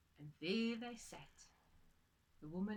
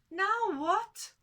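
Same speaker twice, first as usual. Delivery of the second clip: much higher, louder despairing cry; desperate wife; 12 semitones higher, 15 dB louder than usual. Acted. Wife panicking to husband, high despairing cry. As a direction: cry out helplessly.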